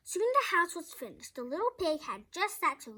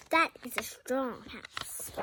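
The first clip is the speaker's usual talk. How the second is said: plain reading voice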